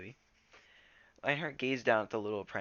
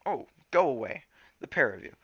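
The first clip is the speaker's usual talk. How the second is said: gruff good-natured shooing; 5 semitones higher, 8 dB louder than usual. A narrator's reading. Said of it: "half-hearted growl".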